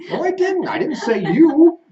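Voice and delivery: high, loud mock-innocent protest; playful denial. Real, not acted. Husband protesting to his wife, high and loud mock innocence. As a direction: squeal out a denial you never said it.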